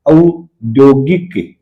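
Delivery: औद्योगिक drawn out very slowly, each syllable given its time; slow teacher demo of औद्योगिक; slow spelled-out word. Shown as a demonstration. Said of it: "देखो ऊ का औ हो रहा है".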